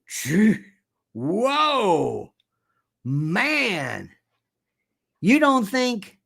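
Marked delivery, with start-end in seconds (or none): 0.1-4.1 drawn-out, amazed gee whoa man; a hint of an impressed Turkish leader; awed Erdogan. Erdogan watching Trump, amazed: mild mock awe. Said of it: "Erdogan's thinking".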